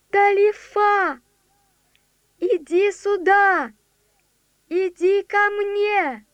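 slow high coaxing call; child calling. Acted. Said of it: "голос Лори".

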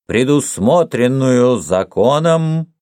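slow, high, measured courtroom reading; pronouncing a sentence. A narrator's reading. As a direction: hand down the sentence, word by word.

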